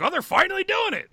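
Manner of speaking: high, loud retold exclamation; excited reaction. Telling co-hosts of the torn-down lot, excitedly. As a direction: burst out with long-awaited news. Retelling his reaction to the demolition.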